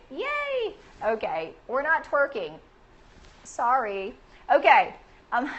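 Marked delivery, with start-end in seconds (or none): 0.1-0.7 cheering. Real, not acted. Host celebrating a viewer's introduction, high cheer. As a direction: let out a high, drawn-out little cheer.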